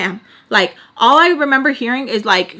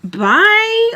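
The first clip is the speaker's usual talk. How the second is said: much higher, louder disbelieving bye; staring at Sam, a high louder dismissive bye; incredulous bye; 12 semitones higher, 5 dB louder than usual. Retold or imitated.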